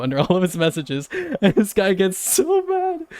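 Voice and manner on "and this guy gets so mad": much higher, slow, strained exclamation; incredulous squeal. Real, not acted. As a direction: squeak it out in disbelief. Exclaiming about the angry troll.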